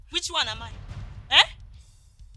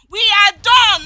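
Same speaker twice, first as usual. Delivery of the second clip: firm loud breakup; 5 semitones higher, 9 dB louder than usual. Acted. Breakup declaration burst.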